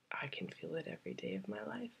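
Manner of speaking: a fast, soft whisper of conviction; quiet heartfelt whisper; hushed belief. Real, not acted. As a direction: breathe it like a private promise.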